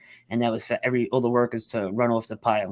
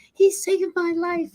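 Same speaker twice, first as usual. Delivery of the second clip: much higher, slow imitation of the woman; grateful woman; 3 dB louder, slower than usual. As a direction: pitch it way up and draw it out, lightly mimicking her. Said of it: "She's like".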